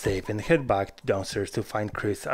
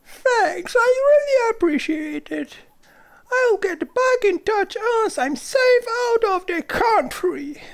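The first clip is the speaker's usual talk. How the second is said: grateful high Chris voice. Acted.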